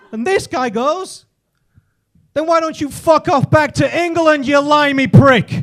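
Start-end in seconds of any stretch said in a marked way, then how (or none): 2.3-5.6 irate New Yorker. Acted. New York customer to Englishman in the deli, irate. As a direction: hurl the insult across the queue.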